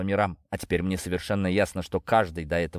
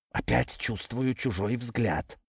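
slow, softer wary snake; slow quiet serpent voice; 4 dB quieter, slower than usual. A narrator's reading. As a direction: murmur it slowly, uneasy. Serpent uneasy.

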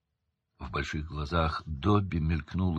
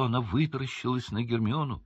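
ordinary delivery, nothing marked

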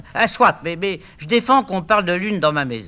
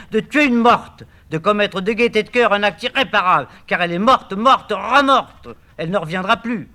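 building to a high, loud, slowed indictment; mounting outrage, last words slowed; rising accusation; 6 semitones higher, 3 dB louder than usual. Acted. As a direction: hammer home each accusation. Hortebise accuses Orphée.

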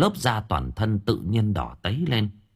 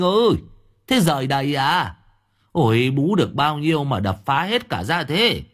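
put-on high, loud scolding drunk old man; tipsy neighbour nagging loudly; drunk neighbour calling; 6 semitones higher, 3 dB louder than usual. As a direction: call out to him across the yard, half scolding.

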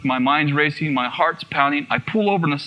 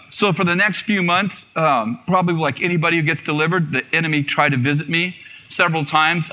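ordinary delivery

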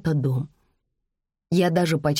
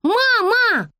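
put-on very high little girl's shriek; loud, high-pitched childish wail; indignant yell; 5 dB louder than usual. A narrator's reading. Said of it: "возмущённо завопила девочка".

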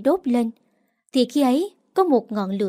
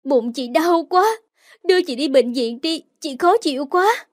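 in pain; 4 semitones higher than usual. Acted. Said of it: "tôi mới nói thành tiếng".

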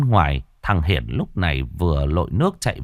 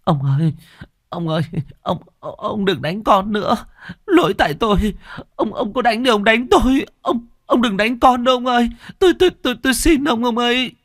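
very high, wailing plea; tearful begging. Acted. Wife begs husband to spare their son, tearful begging. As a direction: beg desperately through sobs, repeating and stammering. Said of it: "mếu máo van xin".

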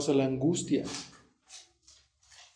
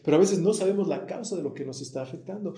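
normal talking voice

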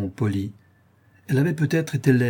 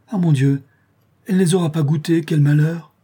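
louder, higher mock-tragic wail over the food; lament; 6 semitones higher, 6 dB louder than usual. A narrator's reading. Old wife lamenting the undercooked mushrooms, loud and dismayed. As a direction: throw up your hands in dismay.

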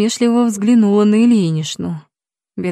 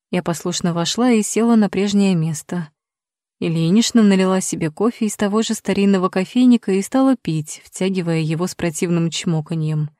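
usual speaking voice

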